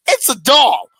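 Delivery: high and loud, incredulous; mock scolding at a crying kid; exasperated retort. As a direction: tell her off like it's obvious. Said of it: "I was like".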